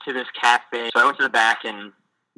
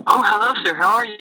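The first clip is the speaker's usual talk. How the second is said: much higher, overly polite hello; eager dad greeting; 11 semitones higher, 3 dB louder than usual. Retold or imitated. Missionary imitating the Filipino dad greeting him, pitched much higher.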